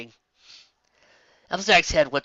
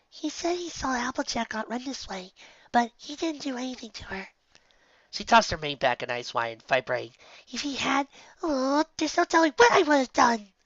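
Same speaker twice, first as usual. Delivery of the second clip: timid Fluttershy voice; 11 semitones higher than usual. A narrator's reading. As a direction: shrink back, voice small and high.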